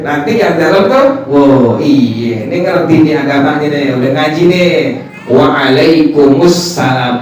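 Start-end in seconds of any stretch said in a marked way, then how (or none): 5.3-7.2 Waalaikumsalam slow and complete; every syllable of Waalaikumsalam; careful proper reply; correct full reply. Shown as a demonstration. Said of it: "Apa jawabnya?".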